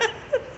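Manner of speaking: a burst of high laughter; real giggle. Real, not acted. Laughing at the memory.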